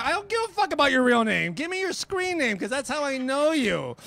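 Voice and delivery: high, fast exasperated rant. Retold or imitated. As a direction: scold them in disbelief.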